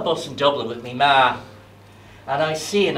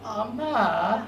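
higher, softer whining plea; pleading child; 9 semitones higher, 5 dB quieter than usual. Acted. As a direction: beg like a small kid. Child begging mother.

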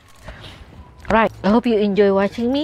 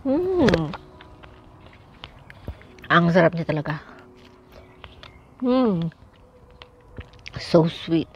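talking while chewing; savoring fresh strawberry; mouth full; 2 semitones lower, slower than usual. Real, not acted. Seen 3: Bites strawberry at -1.0, chews through; bites another at 3.8.

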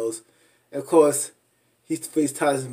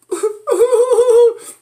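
squealing laugh, high and loud; a real high cackle of delight; high shrieking laugh; 10 dB louder than usual. Real, not acted. Vlogger cracking up at Scott's apology, shrieking laugh.

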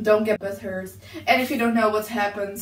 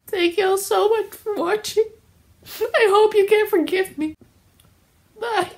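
high, sugary fake-sorry sign-off; mock sincere outro; 9 semitones higher than usual. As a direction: end the apology sweetly pitched up.

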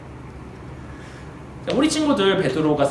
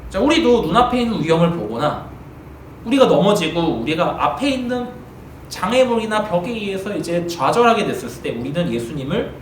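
usual speaking voice

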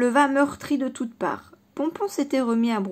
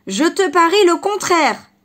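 loud, higher angry shout; furious yell; 6 semitones higher, 8 dB louder than usual. Acted. The enraged fox yells his bet at the rabbit, loud and furious. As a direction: bark the bet in rage. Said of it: "hurla Renard, vert de rage".

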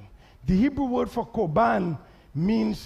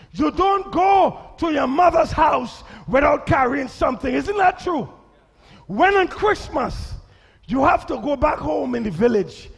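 loud, high preaching; 6 semitones higher, 5 dB louder than usual. Acted. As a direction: call it out to the church. Preaching at full force.

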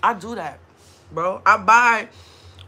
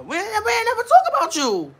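ordinary delivery

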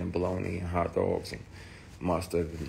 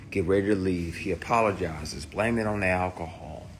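ordinary talk

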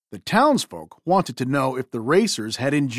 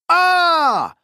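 loud, much higher startled scream; a sudden fright, yelled out high; 13 semitones higher, 6 dB louder than usual. Acted. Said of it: "screamed Lightning and Sandy".

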